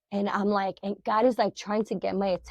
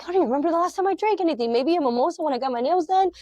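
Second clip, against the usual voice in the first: high, fast, louder disbelieving rattle; incredulous rush; 8 semitones higher, 4 dB louder, much faster than usual. Retold or imitated. Speaker protesting to God she barely drinks, incredulous rush. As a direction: blurt it out in disbelief.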